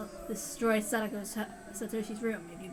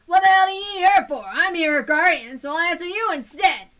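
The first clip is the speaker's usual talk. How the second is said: angry yelling; 3 semitones higher, 10 dB louder than usual. Acted. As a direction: bark at the caller to leave you alone. Teppei shouts at caller.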